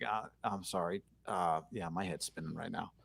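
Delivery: very soft, low mumbled apology; dazed coach imitation. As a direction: imitate a coach giving up on an answer, voice sinking. Imitates coach failing to answer question.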